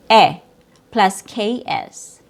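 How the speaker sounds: ordinary delivery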